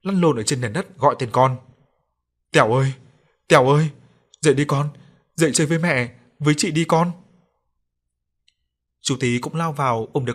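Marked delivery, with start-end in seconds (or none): 2.5-7.1 loud anguished wail, then pleading higher; wailing for her child. A narrator's reading. Mother on the floor calling her drowned son, wailing loudly. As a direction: cry out over the drowned son, begging him to wake. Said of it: "vợ chú ấy đang gào lên".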